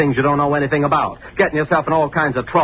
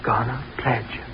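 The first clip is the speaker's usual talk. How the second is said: echoing voice in head; 7 dB quieter than usual. Acted. Taunting voices in his head.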